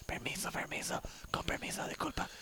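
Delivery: hushed whisper, excusing yourself. Retold or imitated. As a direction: whisper it, dark theater. Sneaking out of cinema.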